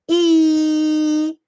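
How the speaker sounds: i held long, high and loud; rising i sound. Shown as a demonstration. Teacher modelling a long high i sound. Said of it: "que vai pra cima".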